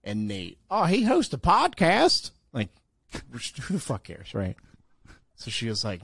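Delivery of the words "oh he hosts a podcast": loud, high, drawn-out jeer; mocking question. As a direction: crow it slowly to embarrass a friend. Mimics Nate teasing him.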